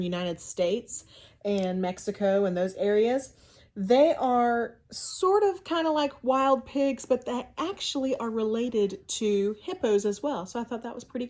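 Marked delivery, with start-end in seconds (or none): none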